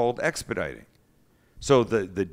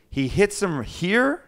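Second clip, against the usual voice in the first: slow dramatic telling; 7 semitones higher, much slower than usual. A narrator's reading. Dramatic climax of story.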